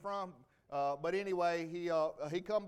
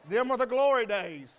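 louder, punching glory; louder, emphatic; 3 semitones higher, 11 dB louder than usual. Real, not acted. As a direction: proclaim it to the congregation. Fond nostalgic remark, repeated.

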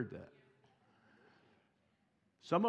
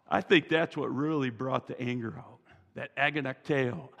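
normal talking voice

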